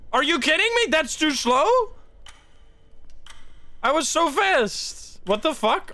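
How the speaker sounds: high, stretched-out disbelief; incredulous protest. Real, not acted. Gamer failing a timed puzzle, incredulous protest. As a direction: draw the words out, pitched high in outrage.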